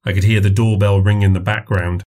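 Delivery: ordinary delivery, nothing marked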